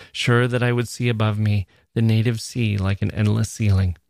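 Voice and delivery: ordinary delivery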